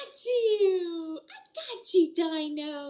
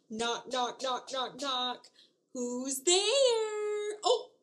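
ordinary delivery

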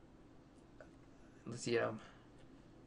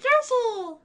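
high, drawn-out creature cry; Yoshi; 6 dB louder than usual. Acted. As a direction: squeak it out high and loud.

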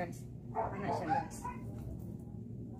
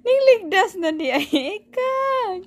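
slow, higher and louder teasing; drawn-out teasing; 9 semitones higher, 6 dB louder, slower than usual. Real, not acted. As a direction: stretch it out, teasing the pet.

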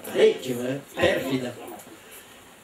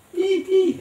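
acutissimo "ih ih"; risatina furtiva. Acted. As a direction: ridacchia tra sé, in falsetto. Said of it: "rideva Joseph tra sé". (risatina recitata, acutissima)

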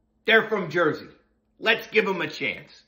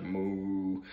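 low, soft, sad moo; lightly voiced sad cow mooing; 8 semitones lower, 11 dB quieter than usual. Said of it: "the cows would moo all sad".

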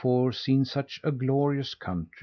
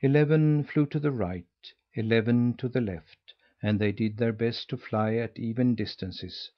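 usual speaking voice

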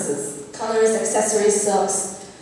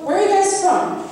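lightly voiced nosy stranger, higher pitch; lightly acted, higher-pitched small-talk question; casual nosy stranger; 7 semitones higher than usual. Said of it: "when somebody asks my friend and I".

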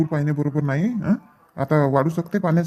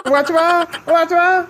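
panicked cry for help; 10 semitones higher, much slower than usual. Acted. Drowning boy screams to the villagers for help, panicked. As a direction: scream for help as the water takes you.

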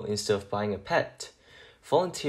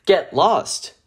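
loud, higher snapped brush-off; rude brush-off; 6 semitones higher, 8 dB louder than usual. Acted. Junkyard guy shooing the stray mutt away, angry. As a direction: snap it to shoo a stray away.

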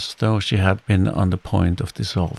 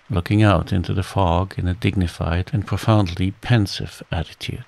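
plain reading voice

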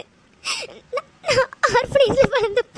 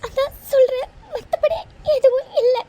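tearful pleading with breaking voice; crying. Acted. Girl tells her friend she never wanted pity, crying. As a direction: cry while insisting it isn't for sympathy. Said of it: "அழாத".

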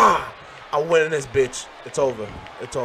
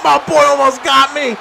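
laughing shout; 5 semitones higher, 5 dB louder than usual. Real, not acted. Just escaped a near loss.

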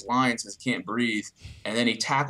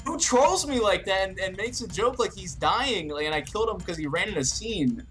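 excited disbelief; 9 semitones higher than usual. Real, not acted. Indignant laughing retelling.

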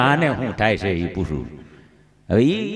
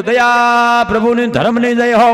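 louder, higher, slow priestly chant; fake priest blessing; 7 semitones higher, 5 dB louder, slower than usual. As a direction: bless the house grandly like a holy man. Conman poses as a priest.

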